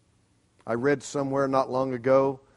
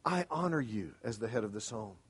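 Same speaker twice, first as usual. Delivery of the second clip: soft, tender but firm refusal; wife to husband, softly and lovingly; 4 semitones lower, 7 dB quieter than usual. Shown as a demonstration. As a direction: say it gently to the husband, holding the line. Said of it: "then very lovingly say".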